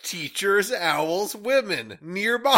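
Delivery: high loud mock shout. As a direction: bellow the list.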